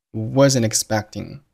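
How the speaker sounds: wasn't expecting joined, drawn out slowly; wasn't expecting as one slow word, "wuzznexpecting"; linked words. Shown as a demonstration. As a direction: slowly run wasn't straight into expecting, no gap. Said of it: "wasn't和expecting发生了辅元连读".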